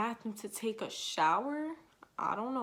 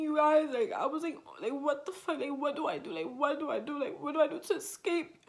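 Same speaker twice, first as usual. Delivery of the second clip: re-enacted panicked wail, higher and often louder; retold crying; 6 semitones higher, 4 dB louder than usual. Telling viewers how they cried with nobody to help, panicked crying. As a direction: act out a helpless, scared cry. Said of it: "it was a cry".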